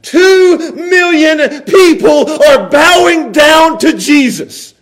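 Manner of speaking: high, driving preacher's voice; fervent preaching. Real, not acted. Preacher paints the crowd worshipping at Jesus' feet, fervent preaching. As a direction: preach it with rising fervor.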